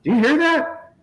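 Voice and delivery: high, excited mimicry of a clueless farmer; giddy mock of the hired man. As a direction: ham up the eager guy straining to hear. Mocking the guy hearing a tractor.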